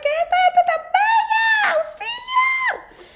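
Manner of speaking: high, loud shouted cry for help; yelling across the room. Pretend. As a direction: shriek it like you're overwhelmed. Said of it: "Perdón por que estoy gritando".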